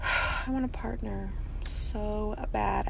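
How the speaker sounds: slow whispered longing. Real, not acted. Lonely mom confessing to viewers she wants a partner, slow whisper. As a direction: breathe it out slowly, barely voiced.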